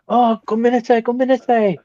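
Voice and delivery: high apologetic gomenasai gomenasai; bow and apologize in Japanese, pitched high; mock apologetic Japanese.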